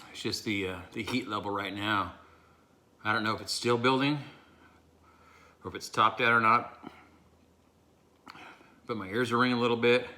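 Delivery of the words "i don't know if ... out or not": ordinary talk, nothing marked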